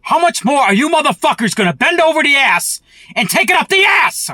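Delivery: yelling high and loud in outrage; furious shout. Real, not acted. Ranting at Biden supporters, a furious rising yell. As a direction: scream it at them, voice climbing to the top.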